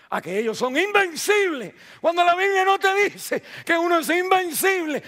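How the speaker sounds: subiendo fuerte y agudo; predicación enfática. Real, not acted. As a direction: clamar con fuerza. (predicación a gritos)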